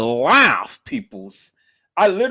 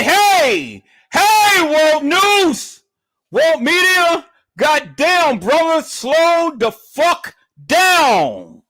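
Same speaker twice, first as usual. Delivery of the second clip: high, loud, drawn-out call to stop; hollering; 6 dB louder, much slower than usual. Retold or imitated. Commentator tells World Media to slow down, high holler. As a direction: holler to halt someone, stretching the last words.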